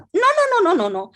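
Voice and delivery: high, loud rapid-fire protest; acted-out indignant refusal; emphatic denial. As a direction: fend off the accusation at once. Retold client's emphatic denial.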